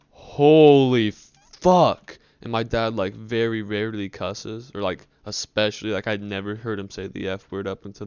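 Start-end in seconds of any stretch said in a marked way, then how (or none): 0.1-2.2 very slow, loud, higher roar of disbelief; shocked shout. Retold or imitated. Dad staring at the bong, a loud stunned shout. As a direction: bellow it in shock. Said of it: "then he goes".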